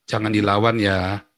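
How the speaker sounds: subdued, measured pleading, retold; imitated fatherly advice. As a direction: quote a father calming his child, slowly. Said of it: "ayah saya selalu bilang apa".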